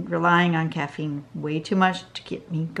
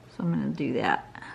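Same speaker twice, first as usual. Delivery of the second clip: whispering; 5 semitones lower, 6 dB quieter than usual. Real, not acted. Muttering to herself while drawing.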